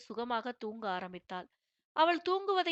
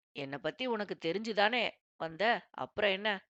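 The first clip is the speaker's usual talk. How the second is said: teasing husband; 2 semitones lower than usual. Acted. Ragu teasing his wife at night.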